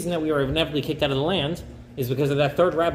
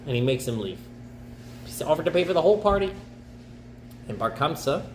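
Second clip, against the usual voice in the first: usual speaking voice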